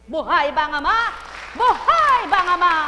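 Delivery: high, loud fervent cry; preaching shout. Acted. Preacher crying out to the congregation at full force. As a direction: call it out with full conviction.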